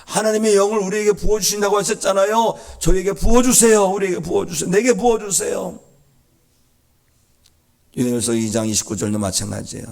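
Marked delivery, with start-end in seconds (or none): 0.0-5.8 loud, high-pitched plea; insistent praying at raised pitch and volume; fervent prayer. Shown as a demonstration. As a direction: cry out urgently to God. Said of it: "말씀 붙들고 기도하는 겁니다".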